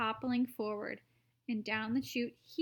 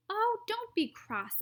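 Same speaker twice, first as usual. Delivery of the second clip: high, breezy and airy; high Wonka voice; 10 semitones higher, slower than usual. Acted. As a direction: brush off the complaint lightly. Wonka soothes.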